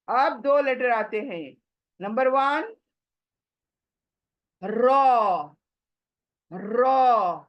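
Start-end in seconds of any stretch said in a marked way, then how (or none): 4.6-7.4 रॉ said with a full, rounded mouth; full-mouthed रॉ, not रे; heavy Arabic ra. Shown as a demonstration. Teacher showing the Arabic letter ra. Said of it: "अरबी में हम क्या पढ़ते हैं?".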